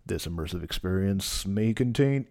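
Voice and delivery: lightly mock drug-ad disclaimer; a hint of a TV drug ad read; pharmaceutical ad. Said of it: "read like, uh, a prescription drug ad on C N N".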